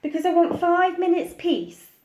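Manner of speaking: a touch of exasperated mum. Acted. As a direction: snap it like a worn-out mum. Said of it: "said Missus Large".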